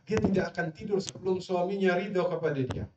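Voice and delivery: ordinary delivery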